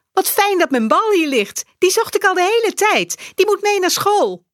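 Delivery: hoog meisjesstemmetje, vrolijk; blij kinderstemmetje. A narrator's reading. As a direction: een enthousiast klein meisje. Saar speaks happily. (Saar vertelt blij over haar bal)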